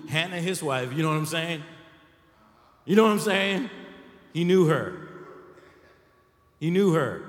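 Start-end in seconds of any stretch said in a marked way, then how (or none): 2.8-3.7 knowing hint. Shown as a demonstration. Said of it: "Some of y'all ain't getting that". 4.3-5.1 suggestive hint. Shown as a demonstration. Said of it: "rated G version of something else". 6.6-7.2 suggestive hint. Shown as a demonstration. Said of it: "rated G version of something else".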